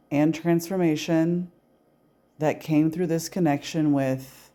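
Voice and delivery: slow, measured meditation voice with long pauses; unhurried, evenly paced guided affirmation. Acted. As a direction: lead a calm release script, phrase by phrase, leaving room to repeat. Leads a guided release meditation.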